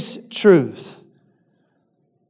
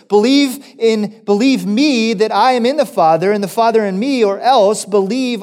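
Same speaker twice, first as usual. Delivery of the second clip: preaching; 4 semitones higher than usual. Acted. Preacher reading Jesus' words to Philip, rising and quickening. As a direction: let the scripture build quickly with rising conviction.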